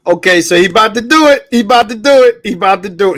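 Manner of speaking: hype friend cheering. Real, not acted. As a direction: hype him up like a fan. Hyping friend before punchline.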